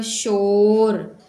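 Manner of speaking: धीमे, खींचकर "शोर"; शोर को लंबा खींचकर बोलो; 1 stretched word. Shown as a demonstration. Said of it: "शा ओ शोर". (अक्षर जोड़कर बताते हुए धीमा)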